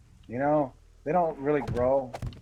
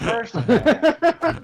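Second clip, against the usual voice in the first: loud, slow, high call; loud drawn-out shout; 11 semitones higher, 11 dB louder, much slower than usual. Real, not acted. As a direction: bellow it out slowly. Calling loudly.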